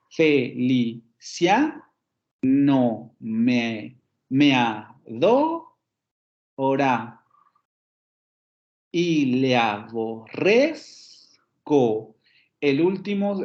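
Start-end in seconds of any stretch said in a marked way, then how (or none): 0.1-10.9 "Felicia no me adora y le aborrezco" very slow, rising on CIA, DO, RREZ; drawn-out metrical demo of "fe-li-CIA, a-DO-ra, a-bo-RREZ-co"; stressed syllables marked. Shown as a demonstration. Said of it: "tenemos dos sílabas átonas".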